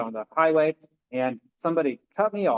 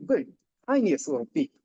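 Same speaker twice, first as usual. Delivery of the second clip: ordinary talk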